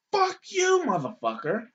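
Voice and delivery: high-pitched, furious "Fuck you"; the old lady snapping back, way up high; mock old lady cursing.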